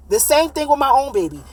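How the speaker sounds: ordinary talk, nothing marked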